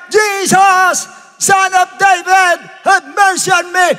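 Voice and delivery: high, loud, drawn-out cry. Acted. Bartimeus begs Jesus despite the crowd, shouting. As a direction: holler each phrase at full force. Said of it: "lalong sumigaw".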